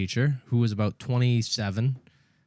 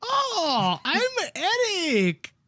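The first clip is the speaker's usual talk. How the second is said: high, drawn-out mocking accent caricature; mocking Eric; much slower than usual. Kid teasing his friend Eric, mocking accent. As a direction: stretch it out in an exaggerated mock accent. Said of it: "I'd walk up to Eric and go".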